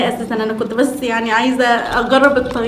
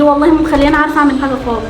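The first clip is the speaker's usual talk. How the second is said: usual speaking voice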